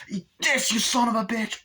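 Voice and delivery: high, loud kill line; defiant shout. Retold or imitated. Kyle jams the pipe in Chucky's mouth, high defiant shout. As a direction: spit the one-liner at him. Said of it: "and she's like".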